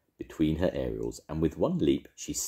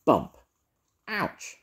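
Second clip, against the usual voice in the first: crying out in pain; 12 semitones higher, 5 dB louder than usual. Acted. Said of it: "cried Edna".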